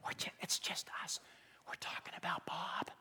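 hushed, unvoiced gossip whisper; conspiratorial whisper. Gossips talk about Bob in a huddle, acted whisper. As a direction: whisper as if letting someone into a secret. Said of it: "if it's being whispered".

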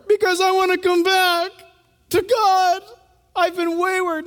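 high, straining voice near tears; retold tearful plea. Young lady explains she wants God back, tearful. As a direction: push it out as if holding back tears. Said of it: "She started crying".

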